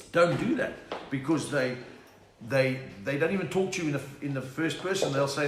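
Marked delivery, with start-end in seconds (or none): none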